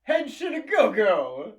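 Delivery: high, loud, slow transformation cry; bright magical girl. Pretend. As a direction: belt the catchphrase like an anime hero. Shouting a transformation catchphrase.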